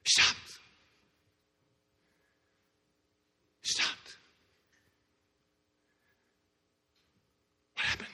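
breathy hushed telling; whispered awe. Acted. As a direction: let the silence speak. Hushed dramatic narration.

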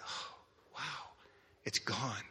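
very soft, awed relief; hushed wonder. Retold or imitated. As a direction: barely say it, amazed. Voicing girl's relief on opening eyes.

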